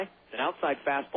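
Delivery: usual speaking voice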